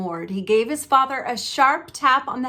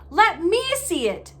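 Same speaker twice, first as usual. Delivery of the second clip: higher, bossy demand; 8 semitones higher, 3 dB louder than usual. Acted. Son demanding to see the letter, higher and bossy. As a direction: order it like a spoiled child. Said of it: "demanded Dudley".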